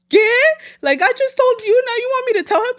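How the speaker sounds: daughter's disbelief at being told to tell dad; incredulous. Real, not acted. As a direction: react like you can't believe it.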